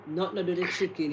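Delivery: normal talking voice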